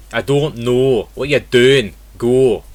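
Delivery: Scottish accent with pure rounded O. Shown as a demonstration. Teacher giving example phrases, Scottish accent. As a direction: put on Scottish, no glide in the O. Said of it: "In Scottish English it will be".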